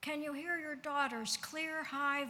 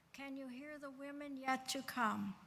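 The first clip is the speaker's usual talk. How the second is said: normal talking voice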